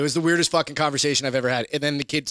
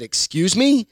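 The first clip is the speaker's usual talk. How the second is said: higher, indignant disbelief in a retold quote; incredulous retort; 6 semitones higher than usual. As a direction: sound shocked and offended. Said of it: "I was like".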